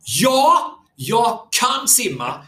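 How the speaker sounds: put-on girl's firm answer. Young girl firmly telling the coach she can swim. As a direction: stop and state it proudly, word by word. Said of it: "då stannar hon upp och så säger hon så här".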